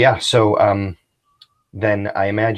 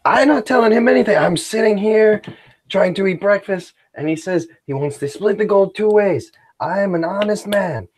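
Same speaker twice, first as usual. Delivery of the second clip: protesting bandit; 12 semitones higher, 4 dB louder than usual. Acted. Tuco protesting innocence, loud.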